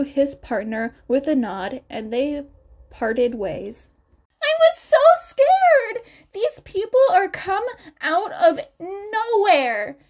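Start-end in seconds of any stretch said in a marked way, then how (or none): 4.4-10.0 put-on high, loud frightened girl; high panicked young girl; scared girl voice. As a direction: squeak it out like a terrified schoolgirl.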